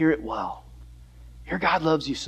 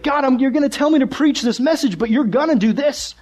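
raised-pitch, pushed protest to God; indignant objection; 8 semitones higher, 4 dB louder than usual. Acted. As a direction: argue as if it's completely unfair. Jonah objecting to God, acted out.

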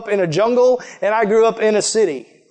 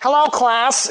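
higher-pitched nerdy teacher voice; nerdy professor; 6 semitones higher, slower than usual. Retold or imitated. Preacher mimics his college science teacher greeting class, nerdy professor voice. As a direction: put on a dorky science professor. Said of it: "he talked like this the whole time".

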